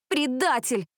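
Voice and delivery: high-pitched, slow outraged reproach; indignant. A narrator's reading. Cheer captain to a classmate insulting the team, indignant. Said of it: "возмутилась Джессика".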